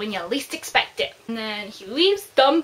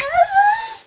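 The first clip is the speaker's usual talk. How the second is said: mock scoff; 9 dB louder than usual. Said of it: "And she's like".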